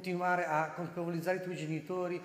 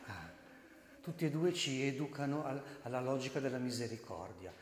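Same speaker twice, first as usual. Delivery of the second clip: usual speaking voice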